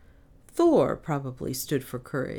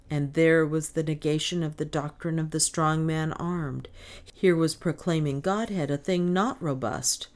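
ordinary delivery

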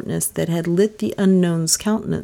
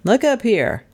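higher-pitched sharp order; commanding; 6 semitones higher than usual. A narrator's reading. Detective commands the dazed stranger, sharply raised pitch. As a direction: order him to raise his head. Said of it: "he commanded".

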